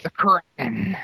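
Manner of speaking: lightly hammed-up monster-movie catchphrase; mock movie battle cry. Host joking with friends about the Kraken, mock battle cry. Playfully booming a film catchphrase.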